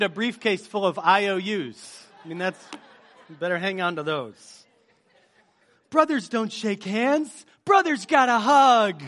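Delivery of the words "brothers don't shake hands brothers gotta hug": slow, loud, high-pitched comic quote; drawn-out goofy movie line; Tommy Boy quote. Acted. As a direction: belt it out slowly like a hammed-up film character. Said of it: "Not enough people said Tommy Boy".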